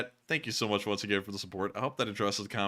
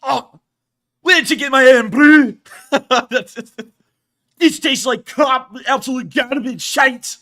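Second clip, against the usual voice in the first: high, loud, ranting Scottish voice, shouting; angry Scottish accent; 13 semitones higher, 15 dB louder, slower than usual. Pretend. Viewer asked for it, streamer rants in angry Scottish accent. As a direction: spit it out like an outraged Scotsman tasting bad Irn-Bru. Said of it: "can you do an angry Scottish accent".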